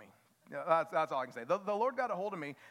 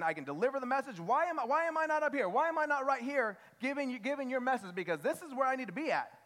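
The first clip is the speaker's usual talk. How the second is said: high, insistent complaint to God; hammed-up entitled protest; mock indignant plea; 8 semitones higher, 4 dB louder than usual. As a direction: act out demanding your place on stage. Voices a self-important believer pleading.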